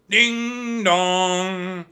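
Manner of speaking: high, loud, long-held ding dong; bell-like chime sung out on a held pitch; ringing bell imitation. A narrator's reading. Imitating the yanked bell rope.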